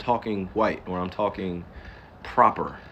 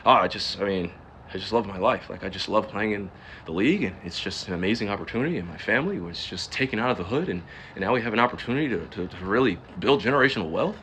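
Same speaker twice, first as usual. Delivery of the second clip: faked media voice; 2 semitones lower than usual. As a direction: fake a humble answer for the cameras. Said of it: "how easy it is to do that to y'all".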